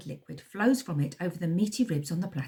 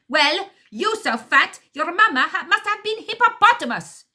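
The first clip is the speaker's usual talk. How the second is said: put-on first henchman yelling insult, heavy accent; angry accented insult; 12 semitones higher, 10 dB louder than usual. A narrator's reading. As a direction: shout the yo-mama jab, thick accent. Accented quarrel continues.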